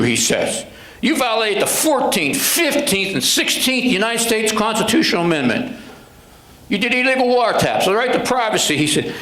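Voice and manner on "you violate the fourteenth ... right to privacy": higher, pushy lawyer voice; indignant attorney. Retold or imitated. Defense attorney threatening the sergeant, higher and indignant. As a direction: scold him like a smug defense lawyer.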